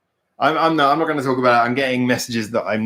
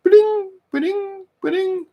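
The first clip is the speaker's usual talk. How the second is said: very high sung ba-ding; high, ringing alert noise; imitated messenger chime; slower than usual. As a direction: mimic a phone notification ping. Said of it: "how much my Facebook Messenger is ringing".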